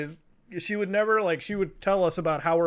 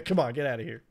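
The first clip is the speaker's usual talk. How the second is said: ordinary talk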